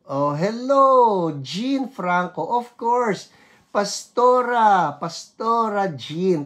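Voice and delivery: high, loud, drawn-out delighted hello; thrilled welcome to Pastora Jean; excited greeting. Real, not acted.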